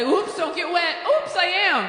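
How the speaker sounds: high, loud mock-coy squeal; mocking taunt. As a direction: play it up high and loud in mockery. Acted mocking of date with umbrella.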